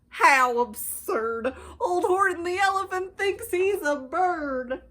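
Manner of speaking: taunting friends yelling; mocking yell. A narrator's reading. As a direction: laugh at him as you shout. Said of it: "they yelled".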